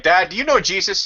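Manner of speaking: put-on high, earnest child question; kid voice. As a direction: ask it like a wide-eyed boy. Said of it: "I'd be like".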